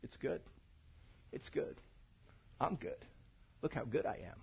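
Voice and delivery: soft, pleased murmur admiring the work; quiet self-satisfied. Pretend. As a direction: hush it, content with what you made. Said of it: "he took a step back and he went like this".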